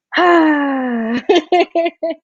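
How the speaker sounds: long, high voiced sigh; drawn-out sigh tipping into a laugh; long sigh of release. Real, not acted. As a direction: let it all out in one long exhale. Emotional release after sharing.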